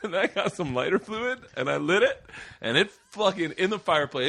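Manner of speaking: high-pitched, laughing as he describes the burnt iguana; can barely get it out for laughing; laughing through story. Real, not acted. Telling friends about the iguana, laughing hard.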